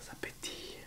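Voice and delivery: hushed whisper over the fizzing drink. Real, not acted. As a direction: whisper it, barely voiced. Listening to the soda fizz. (chuchoté)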